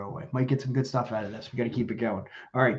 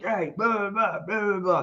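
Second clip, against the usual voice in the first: higher, sing-song mock protest chant; mocking protesters; 7 semitones higher, slower than usual. Host mocks protesters shouting in the parking lot, sing-song. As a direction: brush them off as meaningless noise.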